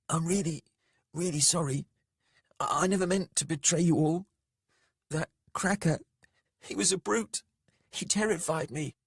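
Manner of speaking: high, halting, frightened apology; wavering voice. A narrator's reading. Jael confessing his betrayal to the group, voice wavering. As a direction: plead haltingly, pitched right up. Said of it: "voice wavering".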